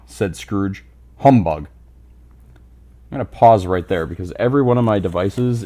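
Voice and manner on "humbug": low, loud scornful growl; grumpy old miser. Acted. Scrooge dismissing his nephew's cheer, low and loud. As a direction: growl it down at him.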